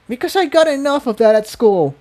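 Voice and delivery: high, hurt voice on the verge of tears; close to tears. A narrator's reading. Tiptoe telling Jigsaw about school bullying, near tears. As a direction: let the old hurt slip through. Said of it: "her eyes beginning to water".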